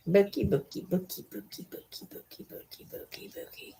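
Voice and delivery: nickname chant fading into a whisper; whispered cooing. Real, not acted. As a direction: repeat the pet name, dwindling to barely a breath.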